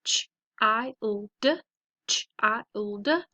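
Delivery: put-on "child" sound by sound, ch, long i, l, duh; segmented phonics "child". Shown as a demonstration. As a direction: sound out "child" twice with gaps. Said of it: "So let's practice them".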